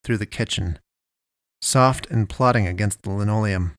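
usual speaking voice

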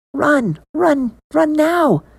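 urgent warning voice. Acted. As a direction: urge him to flee. Inner voice warning.

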